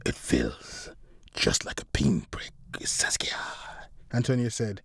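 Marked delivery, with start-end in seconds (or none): 0.0-3.4 very low, whispered villain voice; menacing. A narrator's reading. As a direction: toy with the victim in a deep whisper. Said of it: "Antonio chuckled menacingly".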